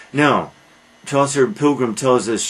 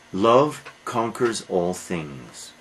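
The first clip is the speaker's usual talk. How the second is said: ordinary talk, nothing marked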